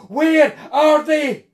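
loud, high, drawn-out shout; desperate, enraged demand; furious demand. A narrator's reading. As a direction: roar the question word by word. Intruder demands his loved ones.

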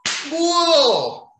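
slow, high, loud Italian-flavoured cry; mock Italian outburst; Italian exclamation. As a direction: throw it out like an Italian uncle. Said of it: "In the words of my friends who are Italian".

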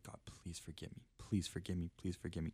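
soft, understated plea; retold begging. Retelling a prayer to God after preaching, soft begging. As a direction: plead under your breath, repeating it. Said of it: "literally was begging for forgiveness".

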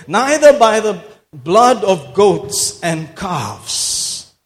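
very slow, loud scripture quote; dramatic proclamation. Real, not acted. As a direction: stretch out the verse like a preacher. Repeats verse as dramatic proclamation.

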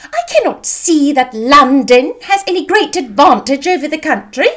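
high, loud matron with swooping emphasis; triumphant mother. A narrator's reading. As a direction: press the point as if victory is yours. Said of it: "continued her triumph".

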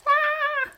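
short, high-pitched cry of pain; loud high "ah!" from a boy who fell; pained scream. A narrator's reading. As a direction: let out a quick high yelp, as if hurt. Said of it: "hurts himself and screams".

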